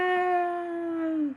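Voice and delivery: high, loud squeal of delight; celebrating the romance with a high, loud cheer; excited cheer. Real, not acted. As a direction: cheer like you won a prize. Celebrating relationship goals.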